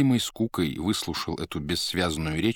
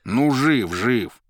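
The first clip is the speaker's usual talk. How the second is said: louder grudging reply; reluctant; 6 semitones higher, 7 dB louder, much slower than usual. A narrator's reading. Азазелло нехотя отвечает Маргарите, неохотно. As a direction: give it up grudgingly. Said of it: "неохотно отозвался Азазелло".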